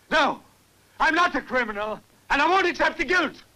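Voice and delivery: loud, high protest; heated denial. Acted. Accused man rejecting the guilt, heated outburst. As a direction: refuse the blame, voice rising sharply.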